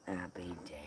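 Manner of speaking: hushed whisper under his breath. Real, not acted. Muttering to himself about the dog, in a whisper. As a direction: breathe it out to yourself.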